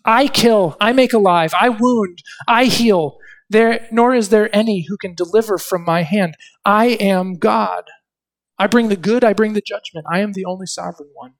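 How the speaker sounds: God's voice declared from the pulpit; preaching scripture aloud. Acted. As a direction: call them to see, commanding. Declaring God's sovereign words.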